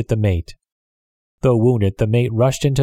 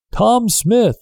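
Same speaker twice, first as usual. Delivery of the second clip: shouting a name into the night; shouted; 12 semitones higher than usual. Acted. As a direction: call his name out to the far boat. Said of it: "the man shouted".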